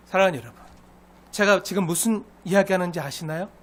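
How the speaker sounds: ordinary talk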